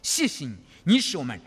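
earnest, fervent prayer voice; closing prayer. Acted. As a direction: pray aloud for the congregation.

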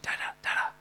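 put-on soft child's whisper. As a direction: breathe it like a little girl's secret. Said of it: "and she'd whisper".

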